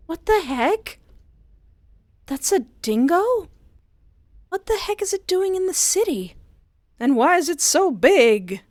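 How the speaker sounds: high, loud disbelief; incredulous. Real, not acted. Player seeing the giant dingo, incredulous. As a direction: gape at the creature.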